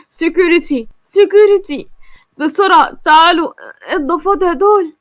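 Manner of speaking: frightened shouting for help; scared yelling for the guards; panicked call for security. A narrator's reading. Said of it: "وهو خايف جدًا وهيتجنن من الخوف".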